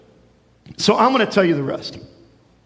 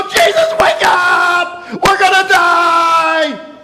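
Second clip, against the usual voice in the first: frantic shouting, slow and much higher and louder; panicked shout; 8 semitones higher, 6 dB louder, much slower than usual. Acted. Disciples shouting to wake Jesus in the storm, panicked yell. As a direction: scream at him to wake before the boat sinks. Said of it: "and they go".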